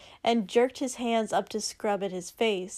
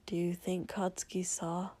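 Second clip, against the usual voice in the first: whispered mutter, much softer; 3 semitones lower, 10 dB quieter than usual. Acted. Shoto anxiously asking Izuku about Katsuki, whispered mutter. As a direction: breathe it out nervously. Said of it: "Shoto muttered".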